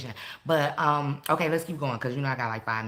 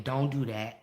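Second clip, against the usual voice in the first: ordinary delivery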